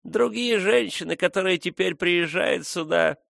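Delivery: put-on fond old woman reminiscing; tender old woman. A narrator's reading. Old nurse recalls Frona's childhood.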